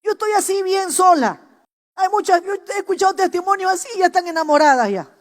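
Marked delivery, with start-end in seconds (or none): 0.0-1.4 much higher, louder, mocking girl's voice; hammed-up girl swearing off marriage; mock single girl. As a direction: imitate a stubborn young woman. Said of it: "Hay una que dice".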